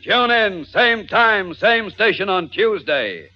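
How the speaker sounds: radio announcer. Acted. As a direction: sell the next episode boldly. Announcer's closing promo.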